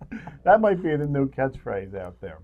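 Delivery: normal talking voice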